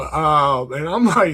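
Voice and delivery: ordinary delivery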